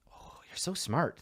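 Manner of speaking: soft unvoiced whisper. Real, not acted. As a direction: breathe it as a whisper. Teasing aside while shopping.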